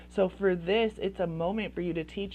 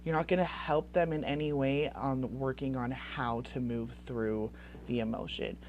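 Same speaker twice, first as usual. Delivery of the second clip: normal talking voice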